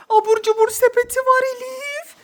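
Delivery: usual speaking voice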